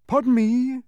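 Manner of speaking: very high, loud protest; insistent humbug. A narrator's reading. Humbug objecting to the officer, insistent protest. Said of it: "insisted the humbug".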